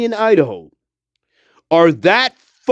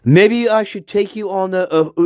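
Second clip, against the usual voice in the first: ordinary talk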